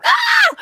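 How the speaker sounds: sudden high "Ah!" scream, replaying the fright; retold startled scream. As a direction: shriek like something just jumped out at you. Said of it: "I screamed, blah, blah".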